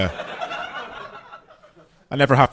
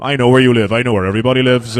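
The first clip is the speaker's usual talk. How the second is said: threatening Belfast voice, a bit louder; menacing Belfast accent; 4 dB louder than usual. Retold or imitated. Belfast man on the phone threatening the caller, Belfast accent. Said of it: "guy from Belfast answers the phone".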